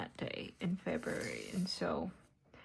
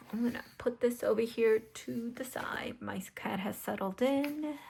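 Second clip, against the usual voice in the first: whispering; 4 semitones higher than usual. Acted. Whispered craft chat.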